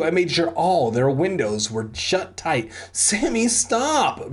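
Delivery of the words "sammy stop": high-pitched plea; hammed-up desperate pleading; begging. A narrator's reading. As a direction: beg them to stop, voice high. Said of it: "Brent begged".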